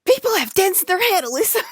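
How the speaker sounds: high, loud fed-up scolding; mock scolding a friend about her head; exasperated Becca. Imitating Becca telling off her friend, high and loud. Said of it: "She would just be like".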